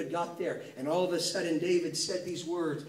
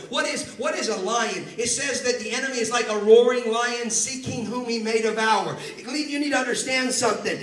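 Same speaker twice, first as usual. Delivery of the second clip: louder, driving sermon delivery; fired-up preaching; 5 semitones higher, 7 dB louder than usual. Acted. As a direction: preach it hard to the congregation. Preaching at full force.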